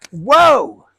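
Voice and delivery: loud startled whoa; spotter's loud warning whoa; yelled whoa. Retold or imitated. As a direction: shout him to a stop. Spotter yelling stop again.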